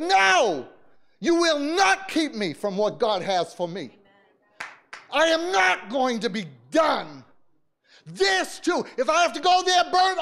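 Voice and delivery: high, loud defiance of the devil; fierce prayer shout. Retold or imitated. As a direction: preach it as if to the devil's face. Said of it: "I didn't care who heard me".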